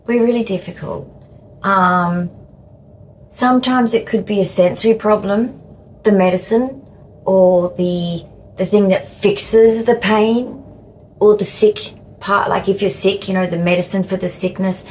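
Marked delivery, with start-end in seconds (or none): none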